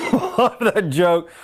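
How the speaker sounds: slow, laughing through the words. Real, not acted. Speaker to audience after the joke, laughing. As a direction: chuckle while savoring it.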